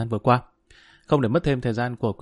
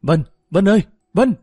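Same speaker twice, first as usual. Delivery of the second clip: calling out loudly for his wife; shouting a name; 6 semitones higher, 4 dB louder than usual. Acted. Husband calling for his wife in the dark house, shouting. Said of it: "Tuấn hét lên".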